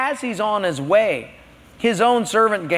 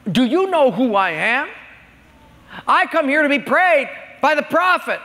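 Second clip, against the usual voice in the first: higher and louder, offended commander; indignant rage; 9 semitones higher, 3 dB louder than usual. Acted. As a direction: demand respect, voice rising. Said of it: "He's in a rage".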